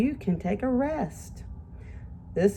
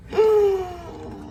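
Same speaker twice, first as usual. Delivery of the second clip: gasp; 11 semitones higher than usual. Acted. Teacher gasps reading story.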